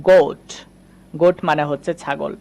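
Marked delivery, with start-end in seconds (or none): 0.1-0.4 গোট with an o vowel, a little louder; model word. Shown as a demonstration. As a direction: say গোট like goat. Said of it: "এটা আমরা উচ্চারণ করি".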